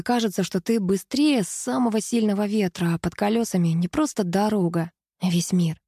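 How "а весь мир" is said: ordinary delivery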